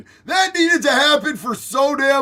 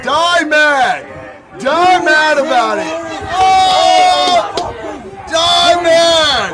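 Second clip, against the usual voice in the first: gloating taunt; 4 semitones higher, 6 dB louder, much slower than usual. Real, not acted. Taunting protesters on video.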